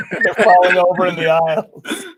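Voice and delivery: laughing through the words; giggling while talking. Pretend. Mock laugh track joke.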